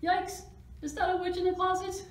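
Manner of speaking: high, loud storybook fright; alarmed. A narrator's reading. Frightened cat spotting a witch in the closet, alarmed. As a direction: squeal it in mock terror.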